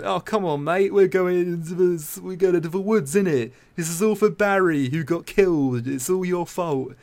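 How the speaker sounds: hammed-up British lad accent; funny British lad accent. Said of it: "funny little accents".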